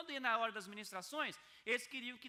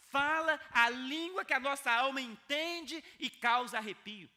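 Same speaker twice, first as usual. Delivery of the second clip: louder, higher and slow, driving each phrase home; full-force preaching; 7 semitones higher, 10 dB louder than usual. Acted. A pastor urging the congregation to stay connected, preaching loud and slow. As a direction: preach it out loud to stir the room.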